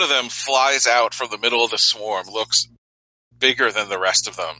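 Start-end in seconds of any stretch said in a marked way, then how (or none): none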